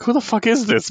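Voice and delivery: high-pitched mock bafflement; incredulous. Taskmaster reacting to the attacker on the motorcycle, high incredulous retelling. Retold startled question to an attacker.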